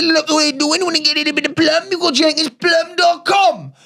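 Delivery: high, shouted jingle-like sign-off; hammed-up ad sign-off. Host urging listeners to the plumber's website, hammed-up shout. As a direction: holler the website, stretching it out. Host closes the sponsor skit.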